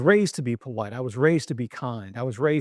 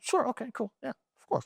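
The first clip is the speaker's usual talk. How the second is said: higher, overly agreeable yes; eager agreement; 9 semitones higher, 5 dB quieter than usual. Retold or imitated. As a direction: nod along brightly. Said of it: "I said".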